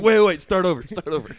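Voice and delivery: high, loud interrupting plea; acted embarrassed shout to restart; flustered restart. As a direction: call a frantic halt. Acting out a botched celebration dance.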